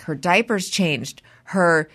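ordinary delivery

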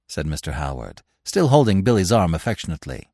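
ordinary delivery, nothing marked

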